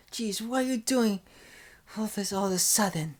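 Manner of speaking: high-pitched flustered protest; flustered character. Acted. Character asking about a sudden change, flustered high voice. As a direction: exclaim in a high, put-on voice. Acted reaction to sudden embrace or crying.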